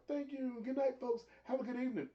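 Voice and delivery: high, lilting host farewell; mock show host sign-off. Retelling Jericho ending the show to his cohost, mock host sign-off. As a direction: sign off like a smug showman.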